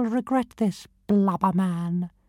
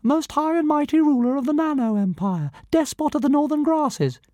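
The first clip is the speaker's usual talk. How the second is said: much higher and fast, rattling off grand titles; fast pompous titles; 8 semitones higher, 4 dB louder, faster than usual. Acted. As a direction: reel off your titles proudly at speed. Dragon reciting its titles.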